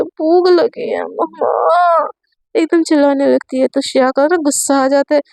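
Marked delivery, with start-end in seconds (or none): 0.0-2.1 put-on slow, drawn-out sick girl's call; whiny patient. A narrator's reading. Sick girl calling for her mother, drawn out. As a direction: stretch it out like a hungry sick girl. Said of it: "एकदम से चिल्लाने लगती है".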